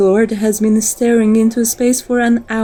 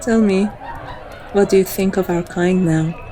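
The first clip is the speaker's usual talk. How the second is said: usual speaking voice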